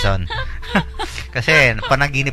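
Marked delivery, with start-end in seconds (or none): 0.3-1.3 breathy high laugh. Real, not acted. Laughs at own punchline.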